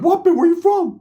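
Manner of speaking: very high, squeaky mock voice; high cartoon voice imitation; Ricky's voice. Ricky confronting cellie.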